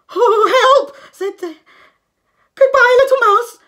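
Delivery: very high, loud squeal; frightened fox. A narrator's reading. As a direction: bolt yelping.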